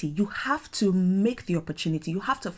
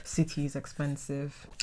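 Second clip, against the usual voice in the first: 1 resigned quiet aside; 5 semitones lower, 9 dB quieter, slower than usual. Retold or imitated. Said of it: "I was like".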